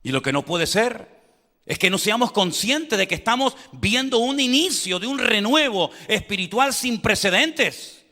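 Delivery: predicar alto y fervoroso, con voz subida; predicación con fuerza. Acted. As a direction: proclama el avivamiento a toda la congregación. Preaches at full force. (predicador a pleno pulmón, con fervor)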